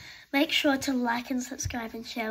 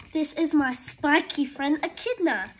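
usual speaking voice

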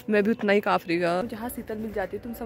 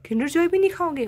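higher, rising in amazed disbelief; surprised disbelief; 7 semitones higher, 4 dB louder than usual. Real, not acted. Child refuses even favourite treat. (हैरानी से, ऊँची आवाज़ में)

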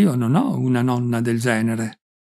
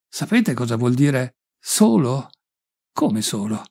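plain reading voice

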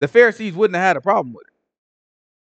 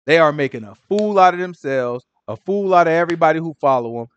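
ordinary delivery

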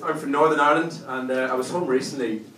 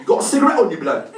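high, loud mock youth demand; street youth; 5 semitones higher, 6 dB louder, faster than usual. Youth asking him for a cigarette, loud pushy voice. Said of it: "He's like".

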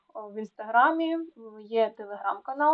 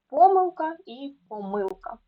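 ПОмилка, then поМИЛка; double stress; 4 semitones higher, 4 dB louder than usual. Shown as a demonstration. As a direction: show both stresses of помилка, first syllable then second. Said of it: "Можна і так говорити".

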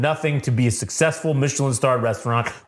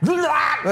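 high yelp imitating a shock; mock electric yelp; 12 semitones higher than usual. Fack shocking himself, high mock yelp. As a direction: yelp high like you just got zapped. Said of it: "then Fack".

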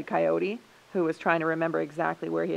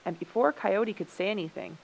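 plain reading voice

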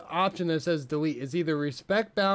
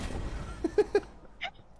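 giddy laugh. Real, not acted. After surviving the fight.

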